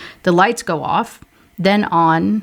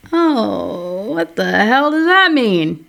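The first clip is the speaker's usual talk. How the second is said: baffled; 4 semitones higher, slower than usual. Real, not acted. Reader reacts to bizarre dress.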